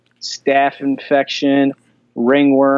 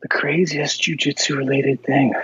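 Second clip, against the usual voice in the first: whisper; 5 dB quieter than usual. Real, not acted. Repeating the question to himself.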